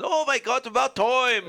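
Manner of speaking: high, loud Canadian accent impression. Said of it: "he had the full Canadian accent".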